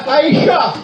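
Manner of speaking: "Aïcha" said high and sharp, like a sneeze "a-ï-CHA". Shown as a demonstration. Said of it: "ça se prononce comme un éternuement".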